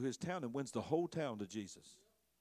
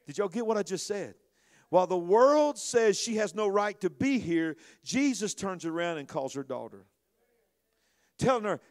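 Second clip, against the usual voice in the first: louder, impassioned sermon delivery; preaching at full force; 8 semitones higher, 11 dB louder than usual. Acted. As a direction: preach it hard to the congregation.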